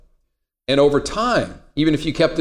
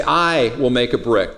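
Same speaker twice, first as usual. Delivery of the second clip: "I" drawn out, then the rest follows; stress on I; 2 semitones lower than usual. Shown as a demonstration. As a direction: lean hard on the word "I". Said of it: "where you put the emphasis".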